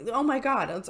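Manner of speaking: normal talking voice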